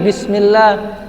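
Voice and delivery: ordinary delivery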